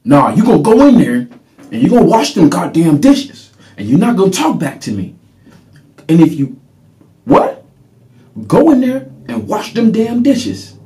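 father's escalating rant, ending in a high shout; reenacting angry father. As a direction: bark the orders at the kid like an enraged father. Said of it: "I'm about to reenact my father".

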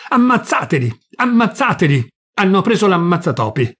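high, loud command yelled at soldiers; commander's yell in the heat of battle; battle shout. A narrator's reading. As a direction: roar the order across the valley. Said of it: "Con la stessa voce".

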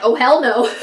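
loud, laughing no way; laughing refusal. Retold or imitated. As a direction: blurt it out through a laugh. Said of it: "And I'm like".

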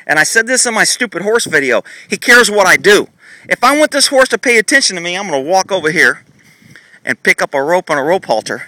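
ordinary talk, nothing marked

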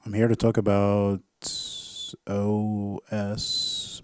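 announcer voice. Pretend. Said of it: "I was just playing around".

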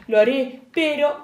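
usual speaking voice

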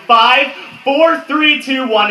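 high, loud countdown; rousing countdown. Acted. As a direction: count down like a crowd about to burst loose. Countdown to senior year in speech.

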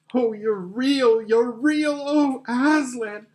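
high, loud, slow, drawn-out rapture; overjoyed cry. Acted. Lucy realises Aslan is real, overjoyed cry. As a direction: cry out in joyful relief. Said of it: "cried Lucy".

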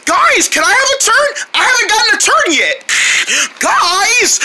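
high-pitched plaintive pleading. Acted. Left-out player asking for a turn, pleading. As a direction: beg for it in a high voice.

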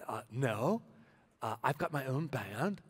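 put-on low, lightly done Dutch accent; a hint of a Dutch accent, declining politely. Guitarist turning down a new band, light Dutch accent. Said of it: "that's my Dutch accent".

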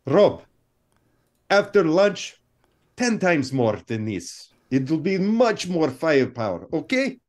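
put-on director in heavy Italian accent. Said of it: "he came with a heavy Italian accent".

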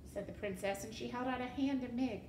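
usual speaking voice

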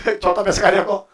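laughing through a mock complaint; amused, breaking into a long chuckle. Real, not acted. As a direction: quote the grumble with open laughter. Mimics complaint while laughing.

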